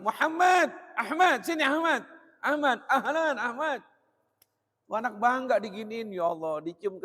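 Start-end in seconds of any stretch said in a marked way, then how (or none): 0.1-3.8 high, loud, fond calling. Acted. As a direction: greet your son exuberantly, pitched way up.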